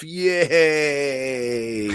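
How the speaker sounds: high, drawn-out playful hooray; mock cheer. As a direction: celebrate with hammed-up delight. Cheers character's return to life.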